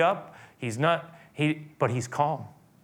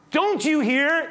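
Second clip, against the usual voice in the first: high, loud exasperated demand; frustrated Pilate; 6 dB louder, slower than usual. Acted. Pilate demanding Jesus answer, high frustrated shout. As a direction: push the question at him, fed up. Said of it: "You can feel his frustration".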